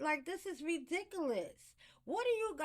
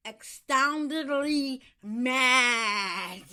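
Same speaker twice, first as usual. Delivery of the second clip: loud, drawn-out, upset emphasis; real anger; 9 dB louder, much slower than usual. Real, not acted. Podcasting healthcare worker upset by elder abuse video, angry. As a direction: stretch each word out slowly and loudly, still mad. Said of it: "As you can hear it in my voice".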